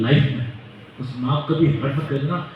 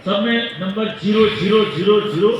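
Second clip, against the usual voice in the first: each zero rising, a touch higher and louder; hammering zero; 5 semitones higher, 3 dB louder than usual. Acted. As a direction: repeat zero like a drumbeat, building. Repeating zero for effect. (हर zero पर ज़ोर, बढ़ता हुआ)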